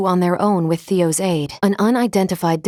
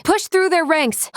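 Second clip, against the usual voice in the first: high, urgent order to push forward; rally them through the enemy line; battle command; 9 semitones higher than usual. Acted. Battle command during retreat.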